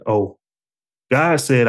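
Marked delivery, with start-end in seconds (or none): 0.1-0.4 mildly humbled, small "oh"; understated humble realization. Acted. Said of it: "in my humble voice".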